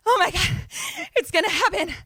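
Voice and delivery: high, loud, breath-heavy ecstasy; hammed-up gasping anticipation, imitating a woman in rapture; mock orgasmic. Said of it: "orgasmic. Like, she's just like".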